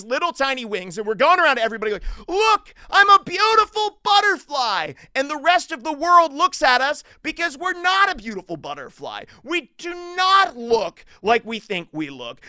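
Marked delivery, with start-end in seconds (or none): 2.3-4.9 high, hammed-up triumphant shout; proud cartoonish cry; boastful shout. Proud boast acted to everybody. 5.1-12.4 raised, emphatic preacher voice; impassioned sermon delivery; forceful preaching. Real, not acted.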